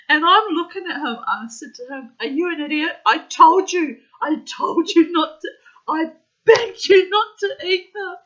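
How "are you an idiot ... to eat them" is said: laughing scold. Retold or imitated.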